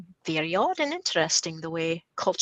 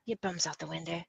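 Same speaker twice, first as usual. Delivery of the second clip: Scottish slang; 11 dB quieter, faster than usual. Shown as a demonstration. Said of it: "can we have an example of Scottish slang".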